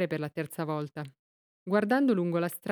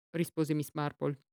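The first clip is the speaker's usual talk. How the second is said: ordinary delivery, nothing marked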